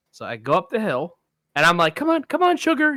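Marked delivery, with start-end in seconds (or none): 2.0-3.0 high sing-song come on, come on; coaxing a dog. Retold or imitated. Calling a big dog up the hill, high coaxing voice. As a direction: coax the dog along, pitched way up. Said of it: "you encourage a dog".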